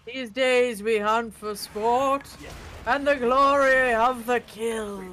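put-on slow, theatrical old huntsman; stuffy old man. Player play-acting a hunter's speech, stuffy old man voice. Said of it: "my stuffy old man voice".

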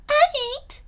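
tiny, very high squeak; snickering skunk. Acted. Skunk refuses to help gator, snickering squeak. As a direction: squeak a cheeky little refusal. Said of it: "snickers Madame Skunk".

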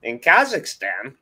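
high, hammed-up Kazakh accent; Borat. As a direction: greet viewers in a mock Kazakh accent. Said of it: "Plus one vote for a Borat video".

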